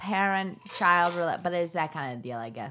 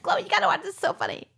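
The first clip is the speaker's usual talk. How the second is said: much higher-pitched quote; imitated eager urging; 12 semitones higher, faster than usual. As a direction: mimic relatives pushing a must-see show. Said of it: "And Gemma and Papa said".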